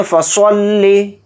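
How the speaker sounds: फसल्लि with a thick, full-mouthed स्वाद; heavy saad. Shown as a demonstration. Teacher demonstrating heavy स्वाद, slow. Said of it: "इस तरह से स्वाद को मोटा पढ़ेंगे".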